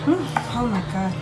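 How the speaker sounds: ordinary delivery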